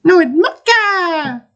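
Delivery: slow, very high MOCHA with a long falling end; high squealed MOCHA. Shown as a demonstration. Gamer correcting friend's pronunciation, drawn-out high squeal. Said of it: "you've been saying it wrong".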